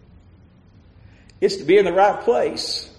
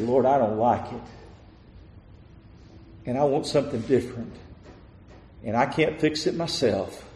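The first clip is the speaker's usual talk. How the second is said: hushed prayer; 4 semitones lower than usual. Acted. Preacher voices David's quiet prayer.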